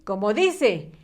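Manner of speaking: ordinary delivery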